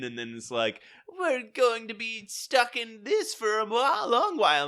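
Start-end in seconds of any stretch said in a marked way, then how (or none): 1.2-4.6 high, loud cartoon whine; villain's complaint. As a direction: put on a hammed-up cartoon villain voice.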